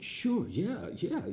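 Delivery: a giddy, can't-believe-it yes; eager yes. Retold or imitated. Answering the invite on the phone, giddy high-pitched yes. Thrilled by the fashion show invite.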